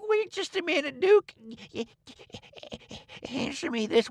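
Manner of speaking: lightly voiced old conman, high and pleading; king bargaining with the Duke; wheedling old king. A narrator's reading. King figure voice.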